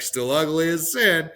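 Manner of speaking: laughing. Retold or imitated.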